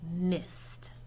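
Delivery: a sudden breathy whisper on the last word; hushed. A narrator's reading. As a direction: drop to a whisper as the shot misses. Dramatic pause before the miss.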